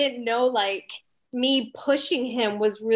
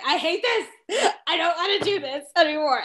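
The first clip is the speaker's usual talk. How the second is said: high, whiny mock sob with a laugh in it; imitating a crying kid; 7 semitones higher than usual. Teacher imitates freshman crying in her office, whiny mock sob. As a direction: hams up the teary freshman, half laughing. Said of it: "come in crying into my office".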